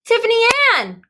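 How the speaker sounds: high, loud call. A narrator's reading. Wally calling to Tiffany Ann on the steps. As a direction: call across to the girl on the steps. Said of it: "called Wally".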